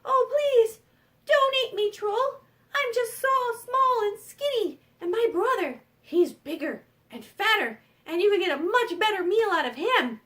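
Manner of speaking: high-pitched begging goat voice; pleading little goat. Acted. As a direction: plead your way out as the smallest goat. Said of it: "the little billy goat tried to think really quickly".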